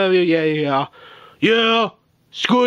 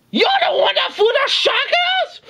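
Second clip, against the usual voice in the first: very high, squealed accusation; outraged shriek. Acted. Victim confronting the one who threw the shark, outraged shriek. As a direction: screech the question in disbelief.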